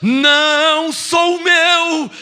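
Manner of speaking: slow, loud and high proclaiming; fervent declaration. Retold or imitated. Believer proclaiming to the church, slow and fervent. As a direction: declare each phrase with full conviction. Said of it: "E você achando que é um pecador".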